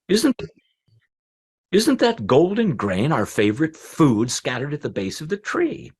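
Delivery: high-pitched, wondering pigeon; wild pigeon. A narrator's reading. Pigeon spotting grain below the tree, high bird voice. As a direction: pipe the question up high. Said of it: "one of the wild pigeons said".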